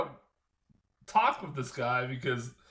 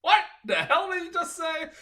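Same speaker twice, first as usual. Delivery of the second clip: much higher, squeaky confused question; baffled disbelief; 14 semitones higher, 3 dB louder than usual. Retold or imitated. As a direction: pitch way up, straining to catch the words. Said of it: "I'm like".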